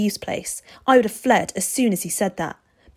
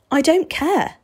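high, loud insistence; stubborn; 9 semitones higher, 6 dB louder than usual. A narrator's reading. Boy refusing the vampire's warning once more, stubborn. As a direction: refuse to budge, voice pitched up. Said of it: "Steve said stubbornly".

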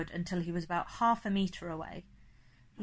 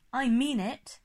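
louder, higher, firm warning; insistent, raised-voice warning; 5 semitones higher, 5 dB louder than usual. A narrator's reading. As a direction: warn him you are serious. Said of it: "I warned him".

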